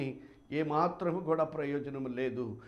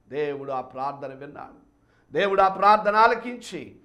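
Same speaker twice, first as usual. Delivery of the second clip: ordinary delivery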